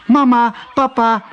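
high, loud, laughing call to their parents; little ducklings calling, giggling; excited calling out. A narrator's reading. Ducklings calling their parents at the pond, high excited laughing call. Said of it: "riepen ze".

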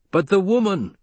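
high, falling, pointed objection; hint at a threat, pitched high; death in the voice. A narrator's reading. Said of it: "There had been death in that voice".

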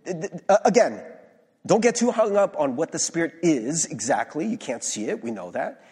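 ordinary talk

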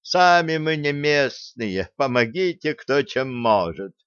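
sarcastic begging chant; mock beggar. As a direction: drag it out like a street beggar. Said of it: "и табличку в руки".

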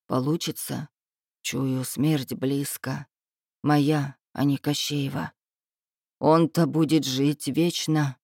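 low, slow, resigned voice; heavy and subdued, sensing the end; deep regret. A narrator's reading. Tsarevich senses his death is near, sadly resigned. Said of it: "посмотрел на меня с таким глубоким сожалением".

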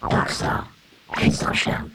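ordinary talk, nothing marked

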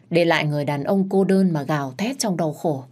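plain reading voice

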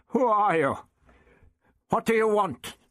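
high, urgent questioning; challenging. A narrator's reading. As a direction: call out a challenge into the glare, pitched high. Doctor challenges the unseen voice.

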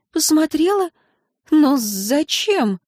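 high, baffled questioning; surprised, uncomprehending. A narrator's reading. Wife asks husband why she must be examined, baffled. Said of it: "удивлённая и непонимающе".

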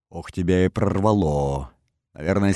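usual speaking voice

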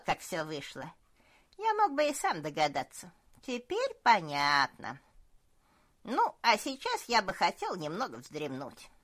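put-on loud, smug old cook; jovial old cook. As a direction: boast like a fat, pleased old cook. Cook laughs and boasts.

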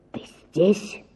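hushed whisper; шёпот. Acted. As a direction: whisper the question. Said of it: "сказал он шёпотом".

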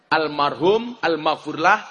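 ordinary talk, nothing marked

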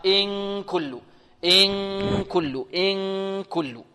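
long held nasal hum on ن of إن before كل; slow recited إن كل with the hidden ن hummed; full ghunnah. Shown as a demonstration. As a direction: stretch إن into a nasal "ing" before releasing كل. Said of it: "نعطيها غنة كاملة".

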